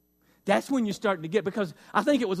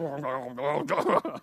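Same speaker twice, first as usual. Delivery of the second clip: low wordless grumble; muttered mock-complaining noise; imitated grumbling; 6 semitones lower, 4 dB quieter than usual. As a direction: grumble under your breath in mock complaint. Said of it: "because of that".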